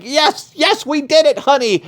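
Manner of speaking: high, thrilled mock cheering; excited cheer. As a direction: celebrate the big win with your honey. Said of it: "be like".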